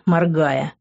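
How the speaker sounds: plain reading voice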